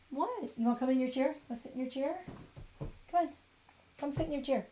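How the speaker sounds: high soft pet-talk voice. Real, not acted. Baby talk to cat.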